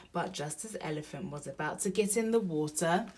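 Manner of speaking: usual speaking voice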